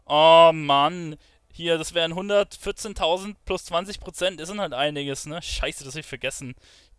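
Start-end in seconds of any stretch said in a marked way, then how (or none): 0.0-1.2 frustrated groan. Real, not acted. Forgot to use item.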